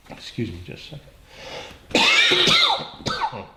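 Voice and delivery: hoarse, holding back a cough; tickly throat, straining; throat tickle. Real, not acted. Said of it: "a little tickle".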